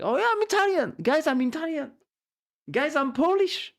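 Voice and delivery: high, loud mock brag about Italian roots; mocking American boast. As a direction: ham up a proud American showing off a bit of ancestry. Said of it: "the Europeans are always making fun of them".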